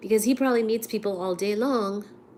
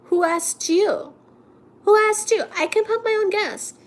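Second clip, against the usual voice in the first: higher, mock offended snap; indignant retort; 10 semitones higher, 4 dB louder than usual. Retelling her snippy reply to the attendant. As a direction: throw it back at him, affronted. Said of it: "And I'm like".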